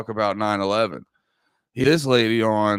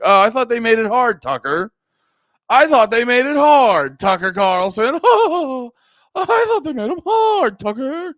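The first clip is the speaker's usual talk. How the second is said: high, loud incredulous mock, climbing higher each time; mocking Tucker Carlson; 13 semitones higher, 7 dB louder than usual. Podcaster mocking Tucker Carlson's claim, high loud disbelief. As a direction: scoff it back at him, higher and higher. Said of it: "That's how he would say it".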